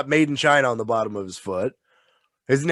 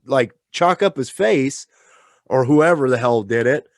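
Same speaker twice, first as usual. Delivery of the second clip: ordinary talk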